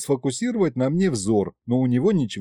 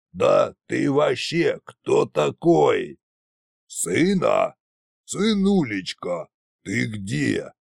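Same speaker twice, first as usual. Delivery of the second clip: put-on slow, slurred-style drunk voice; drunken tsar; much slower than usual. A narrator's reading. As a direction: draw it out like a confused drunk tsar. Drunken tsar talking to a mirror. (медленно, голосом пьяного царя)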